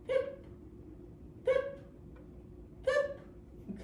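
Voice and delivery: high, soft little poop with a laugh; goldfish kiss sound. Shown as a demonstration. Teacher leads kids in high, playful goldfish kisses. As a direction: pop out tiny high fish kisses. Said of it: "make the sound of our goldfish kiss".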